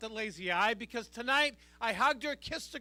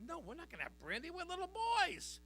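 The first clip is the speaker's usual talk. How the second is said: soft imitation of protesting kids, rising high; little boys; 6 semitones higher, 7 dB quieter than usual. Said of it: "We said".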